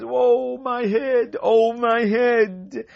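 high, drawn-out moan of a headache; hammed-up pained moan; mock cry of pain. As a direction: wail it slowly, clutching your head. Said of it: "He screams out and he says".

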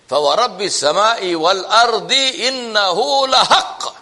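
fast, chanted Arabic recitation; Quran recitation. Acted. Preacher recites the verse to the congregation, chanting fast. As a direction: recite the ayat in a flowing chant.

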